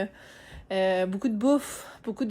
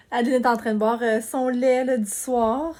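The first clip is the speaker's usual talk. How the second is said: ordinary delivery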